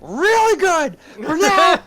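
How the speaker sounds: rallying shout to the crew; shouting over the storm. Acted. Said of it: "shout over the noise to the crew".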